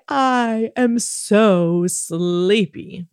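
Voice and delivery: very slow, drawn-out mock sleepiness; dramatically sleepy. Shown as a demonstration. Host shows children the bedtime line, dramatic sleepy delivery. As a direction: stretch every word like a sleepy actor. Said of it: "be very dramatic about this".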